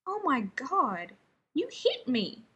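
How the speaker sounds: slow, stunned disbelief; slow amazed gasp at being struck. Acted. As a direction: drag it out, amazed he was hit. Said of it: "sounding amazed".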